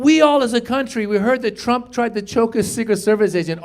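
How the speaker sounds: ordinary talk, nothing marked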